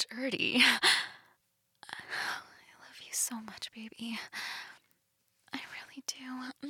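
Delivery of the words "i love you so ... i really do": soft whispered endearments; sweet nothings. Acted. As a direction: whisper it tenderly, close. Tender whispered love to partner.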